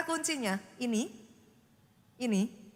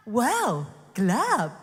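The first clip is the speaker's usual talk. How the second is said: mock God's comment; 3 semitones lower, 5 dB louder, much slower than usual. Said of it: "apa yang Tuhan katakan".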